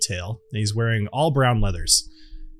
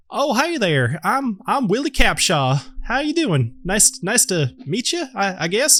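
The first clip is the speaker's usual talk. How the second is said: put-on higher, casual young bard voice; a half-awake lute player saying hello; sleepy bard Willie; 7 semitones higher than usual. Willie meets the strangers in a higher casual voice.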